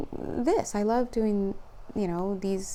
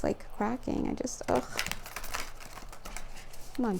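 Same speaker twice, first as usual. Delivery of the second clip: normal talking voice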